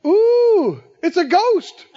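high, mock-scared wail; frightened cry. Frightened disciples spotting a figure on the sea, mock-terrified. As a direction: cry out in pretend fear, loud and high. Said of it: "they cried out for fear".

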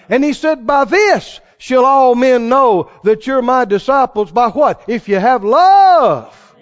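loud, rising preacher's voice at full force; fiery preaching. Acted. Preacher condemning hypocrites to his congregation, loud and fervent. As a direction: drive the point home to the congregation.

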